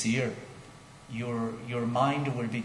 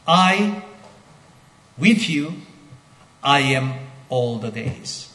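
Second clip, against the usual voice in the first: halting emphatic literal rendering; word by word with pauses; 3 semitones higher, 8 dB louder than usual. Shown as a demonstration. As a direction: space out "I, with you, I am all the days". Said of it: "an emphatic assertion here".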